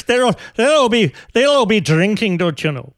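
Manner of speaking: mock Irish lilt, hammed up and failing; bad Irish accent. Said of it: "I can't do Irish accents of any kind".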